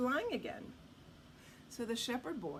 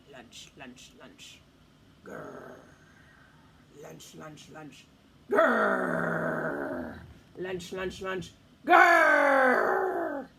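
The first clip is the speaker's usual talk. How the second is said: growling wolves; 7 semitones lower, 11 dB louder, much slower than usual. A narrator's reading. Hungry wolves closing in on the shepherd boy, growling.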